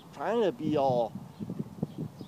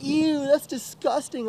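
high, slow mocking disgust; 9 semitones higher than usual. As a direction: imitate her drawn-out revulsion. Imitates her disgust at him.

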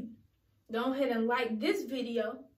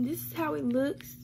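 ordinary delivery

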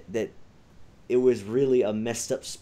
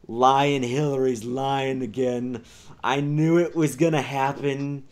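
lightly put-on, louder gloating read-out; mock tweet voice; 4 semitones higher, 5 dB louder than usual. Voicing Donald Trump's tweet.

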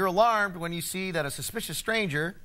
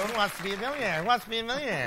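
game show host; much faster than usual. Retold or imitated. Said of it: "Hear that, Regis?".

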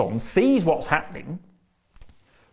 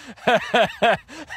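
burst of laughter; 7 semitones higher, 4 dB louder than usual. Real, not acted. Laughing at own story.

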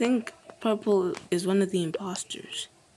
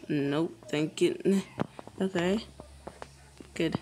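ordinary talk

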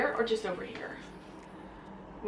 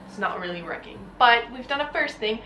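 ordinary talk, nothing marked

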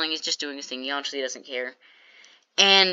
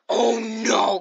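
retold dismay; 4 semitones higher, 4 dB louder than usual. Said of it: "immediately goes like".

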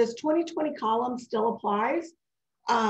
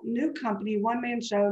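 ordinary delivery

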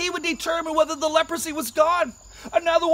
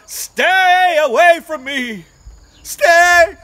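much louder, slow cry; 2 semitones higher, 12 dB louder, much slower than usual. Acted. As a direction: cry out loud to keep him away. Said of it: "he cries out".

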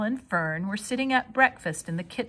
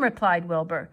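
usual speaking voice

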